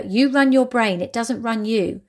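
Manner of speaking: ordinary delivery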